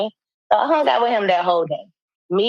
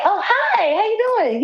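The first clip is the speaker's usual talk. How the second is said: high, sing-song mock greeting; fake sweet greeting; 13 semitones higher, slower than usual. Greeting her angry ex years later, fake sweet. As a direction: greet him in a bright fake-friendly voice. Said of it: "I was just like".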